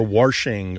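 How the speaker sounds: "washing" said as warshing; intrusive R. Shown as a demonstration. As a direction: add an R before the sh in "washing". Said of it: "people in the United States who do".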